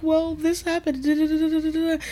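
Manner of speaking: very high mock-crying child voice; imitated tearful child. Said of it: "sad and crying and everything".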